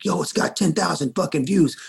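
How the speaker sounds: freaked-out excitement. Retold or imitated. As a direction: burst out with news worth celebrating. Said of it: "we were so freaked out about it".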